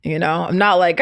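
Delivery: ordinary delivery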